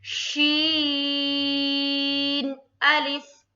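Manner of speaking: शीन अलिफ with अलिफ drawn out very long, loud and slow; tajweed recitation. Shown as a demonstration. Teacher recites for students, long loud drawn-out chant. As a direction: chant शीन अलिफ holding the madd for six beats. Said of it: "आप जो है इसको pronounce करेंगे".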